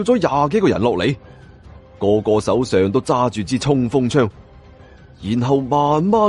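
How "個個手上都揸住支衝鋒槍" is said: ordinary delivery, nothing marked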